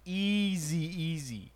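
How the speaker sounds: EASY stretched long on the first vowel; slow, lingering "easy" for emphasis; emphatic drawn-out EASY. Real, not acted. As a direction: draw out "easy", then repeat it. Emphatic repeat of how easy it was.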